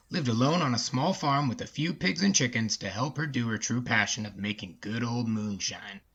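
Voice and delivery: plain reading voice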